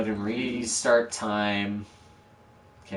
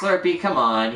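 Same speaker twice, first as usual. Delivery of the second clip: high, loud call to the dog; loud, high call to a pet; 7 semitones higher, 6 dB louder than usual. Real, not acted. Calling his barking dog.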